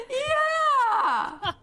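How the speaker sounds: high, loud wailed agreement; mock crying. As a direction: wail it as if bawling at a film. Imitates crying at the film.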